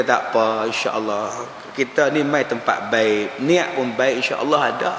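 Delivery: low, soft, soothing voice; calm reassurance. Retold or imitated. Preacher retelling how he comforted a pilgrim with a lost bag, calm reassurance. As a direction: gently settle an upset auntie, pitched well down.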